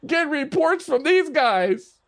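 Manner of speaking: high, slow, laughing delivery; real storyteller's laughter; amused disbelief. Real, not acted. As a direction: laugh through the words, pitched well up. Laughing at his own story.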